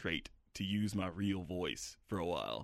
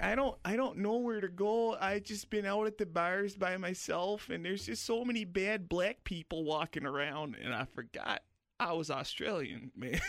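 put-on whiny Upper Midwest accent, much higher than his own; mock northern Midwest accent; 8 semitones higher than usual. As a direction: parody the drunk girl in a Wisconsin-ish accent. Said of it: "'cause they have northern accents up there".

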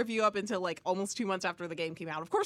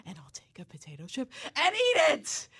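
dramatic whisper; 6 semitones higher, 5 dB louder than usual. Retold or imitated. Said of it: "dramatically takes a chip".

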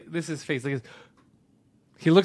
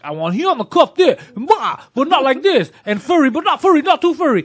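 mock loud demanding; 8 semitones higher, 6 dB louder than usual.

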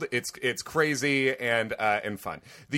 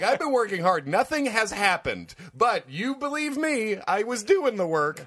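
high, whiny mock excuse; a hint of a pleading executive; 9 semitones higher than usual. Mock quote of Jed Hoyer.

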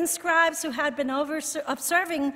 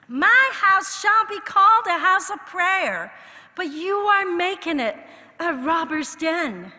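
loud, higher rebuke of the wrongdoers; righteous indignation; 6 semitones higher, 8 dB louder than usual. Retold or imitated. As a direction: quote it with indignant force. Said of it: "it was righteous indignation".

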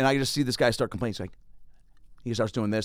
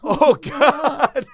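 laughing groan; 7 dB louder than usual. Retold or imitated. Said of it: "I'm like".

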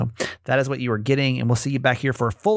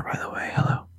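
whispered aside; 5 dB quieter than usual. Real, not acted. Quick whispered aside to listeners.

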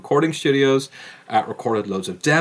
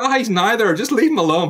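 mock reasonable local; 9 semitones higher, 3 dB louder than usual.

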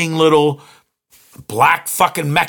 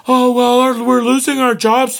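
higher-pitched mocking whine; mock moaning; 5 semitones higher than usual. Cashiers moaning about losing jobs to machines, mock complaint. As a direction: imitate people bellyaching in a raised voice. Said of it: "people bitch and moan".

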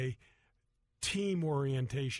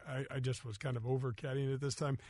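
ordinary talk

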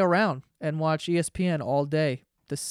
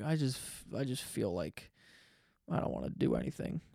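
low, faint, half-whispered complaint; drained, barely voiced whine; weak whining mumble; 4 semitones lower, 11 dB quieter than usual. Imitating lying around lazy sick.

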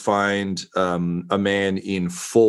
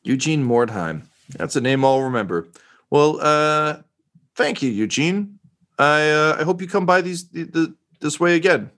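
higher, grateful jaded reporter; eager, pleased to be praised; 5 semitones higher than usual. Acted. Reporter NPC talks about network.

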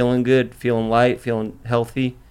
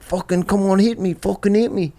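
a light imitation of a Liverpool accent, pitched up; Liverpool accent impression; 7 semitones higher than usual. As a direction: mimic his Liverpool friend daring a punch, lightly. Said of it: "I do your accent".

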